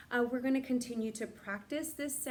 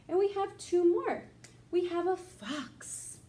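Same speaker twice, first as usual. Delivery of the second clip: bright excited reveal; 8 semitones higher, 4 dB louder than usual. Acted. Excited teacher introducing a fox.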